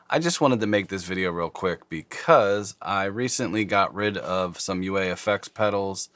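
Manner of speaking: ordinary talk, nothing marked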